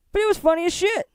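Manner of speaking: high, amused; amused squeal. Real, not acted. Vlogger to viewers, high and tickled.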